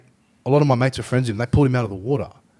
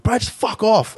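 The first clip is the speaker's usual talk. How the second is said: higher, pushed mock dismissal; other guy telling him off; 10 semitones higher, 4 dB louder than usual. The other guy brushing off the trained mate, pitched up. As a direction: toss it off like an annoyed bystander. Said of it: "this other guy was like".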